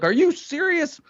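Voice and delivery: high-pitched, incredulous retort; retold outrage. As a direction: re-enact an indignant comeback. Retelling his outraged answer on stage.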